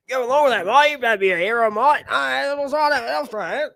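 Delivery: high, loud mock Aussie protest; broad Australian accent, rising on the last word; hammed-up Australian retort; Australian interviewee impression. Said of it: "this guy right here being interviewed".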